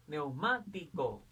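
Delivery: slow "Neumático", syllables apart, MA strongest; stress on MA. Shown as a demonstration. Teacher showing where the voice is strongest, stress on MA in "Neumático". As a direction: sound out "Neumático" and lean on MA. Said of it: "La mayor fuerza voz está en ma".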